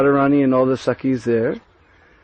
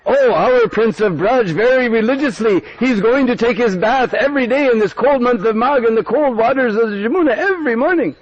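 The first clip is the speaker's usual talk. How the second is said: higher, proud villager's voice; admiring villager; 8 semitones higher than usual. Acted. Villager boasting to Kutila, admiring. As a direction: gush about the prince's devotion.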